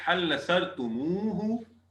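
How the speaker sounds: تمو with the added u, slow and drawn out. Shown as a demonstration. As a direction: spell out تمو ہو slowly. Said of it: "وہ کہتے ہیں". (عربی لہجے میں آہستہ تمو ہو)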